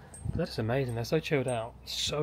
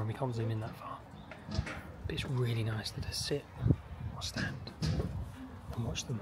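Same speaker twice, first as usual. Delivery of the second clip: library whisper; 6 dB quieter than usual. Real, not acted. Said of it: "I'm just whispering 'cause it has to be quiet".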